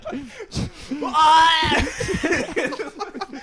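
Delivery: loud, high fit of laughter; helpless laugh. Real, not acted. Telling friends the wine story, real loud laughing fit. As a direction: break up laughing at the memory.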